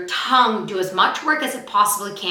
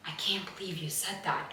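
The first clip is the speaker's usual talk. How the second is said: much softer, lower hurt murmur; hushed disbelief; 4 semitones lower, 18 dB quieter than usual. Shown as a demonstration. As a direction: drop it right down, stunned.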